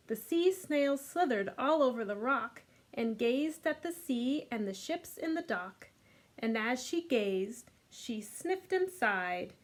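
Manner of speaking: lively storybook read-aloud; reading to children. A narrator's reading. Teacher reading The Snail and the Whale to kids. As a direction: read it warmly to a group of little ones. Reads picture book aloud.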